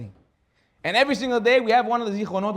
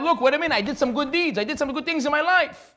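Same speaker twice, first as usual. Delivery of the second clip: higher, louder urgent protest; pleading; 9 semitones higher, 3 dB louder than usual. Acted. Husband pleading with the heavenly court, urgent and high. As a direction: beg the court to count your good deeds.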